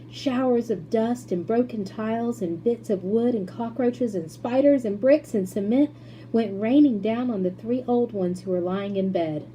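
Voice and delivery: usual speaking voice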